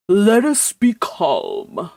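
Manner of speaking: lightly voiced solemn uncle; pompous calm. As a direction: intone it ponderously.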